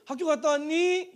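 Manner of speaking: high singsong question; mother's welcoming greeting. Retold or imitated. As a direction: imitate a mother greeting her child from school. Mother's expected friendly greeting, acted. (높은 톤으로 반기는 엄마 흉내)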